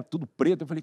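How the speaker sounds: usual speaking voice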